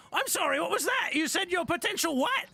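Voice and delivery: put-on high, creaky old goblin greeting; elderly goblin. Old goblin at the ticket booth greets the party, high and doddering. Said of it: "He goes".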